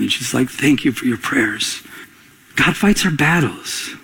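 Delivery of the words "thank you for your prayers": normal talking voice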